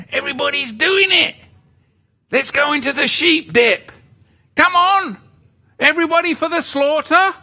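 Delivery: high, loud mocking crowd voice; mocking everybody else. Mocking the crowd herding sheeple, high and sing-song. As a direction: lure the sheep cheerfully to the slaughter. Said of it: "But everybody else".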